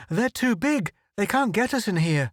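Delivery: high breathless panic; gasping. Acted. Photographer squeezed in the cave, high gasping. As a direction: gasp it out in relief and fear. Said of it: "Click's voice gasped it out".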